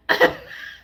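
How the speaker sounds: short high squeal of laughter; high laugh burst. Real, not acted. Amused viewer lets out a high squeal of laughter. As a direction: let a sudden giggle pop out. Reacting to funny clip.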